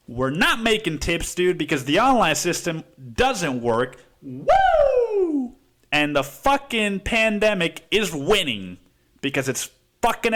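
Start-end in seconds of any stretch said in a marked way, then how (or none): 4.2-5.5 a mock cheer; high ironic whoop; sarcastic whoop. Real, not acted. Mock celebrating the chaos.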